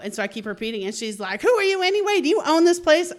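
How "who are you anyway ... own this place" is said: high, indignant mock demand; retold yelling challenge. Angry woman challenging the owner, imitated high-pitched yelling. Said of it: "she's yelling, finger in the face".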